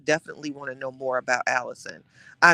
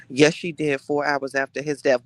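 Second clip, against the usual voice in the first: ordinary talk, nothing marked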